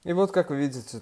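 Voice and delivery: ordinary talk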